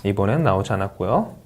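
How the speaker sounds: ordinary delivery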